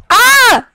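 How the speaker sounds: short, high, loud yell of frustration; frustrated scream. Real, not acted. Player fed up with the game, frustrated scream. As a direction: let out a loud exasperated "Ah!".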